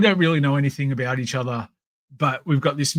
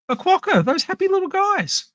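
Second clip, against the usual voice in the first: mock disbelief; 4 dB louder than usual. Real, not acted. Ironic about the biting quokka.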